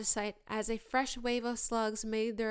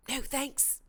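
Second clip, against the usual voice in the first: weakly; 5 semitones higher than usual. A narrator's reading. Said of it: "said Ron weakly".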